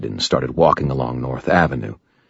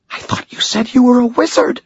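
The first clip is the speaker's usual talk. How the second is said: put-on a much higher girl's voice, pointedly accusing. Girl accuses the wizard of lying. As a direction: hold him to his word. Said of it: "she accused me".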